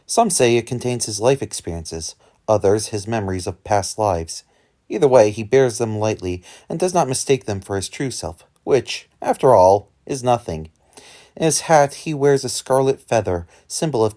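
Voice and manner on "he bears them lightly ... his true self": ordinary delivery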